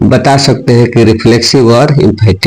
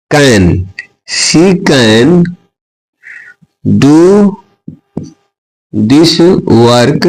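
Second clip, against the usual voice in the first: slow dictation; 4 semitones higher, much slower than usual. Shown as a demonstration. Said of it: "teacher dictating English example".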